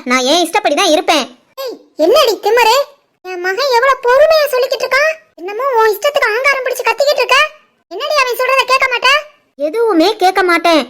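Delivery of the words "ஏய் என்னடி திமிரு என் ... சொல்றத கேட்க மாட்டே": higher-pitched scolding mother-in-law; angry mother-in-law voice. Acted.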